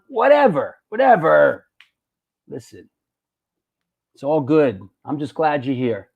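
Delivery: ordinary delivery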